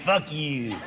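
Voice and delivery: cat's silent thought. Acted. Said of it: "but they're thinking it".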